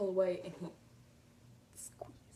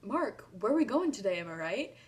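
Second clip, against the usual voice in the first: higher, loud call across the line; yelled at Mark; 3 semitones higher, 8 dB louder than usual. Retold or imitated. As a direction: shout it to get his attention. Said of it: "I yelled".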